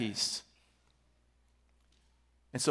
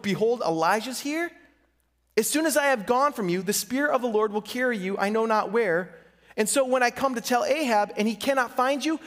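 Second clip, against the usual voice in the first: a lightly voiced fearful servant pleading, higher; frightened Obadiah; 5 semitones higher than usual. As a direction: plead as the scared servant who expects death.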